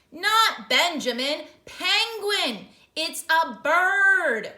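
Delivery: irritated, slow and loud; exasperated correcting. Acted. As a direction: drive the point home slowly. Popper correcting the clerk.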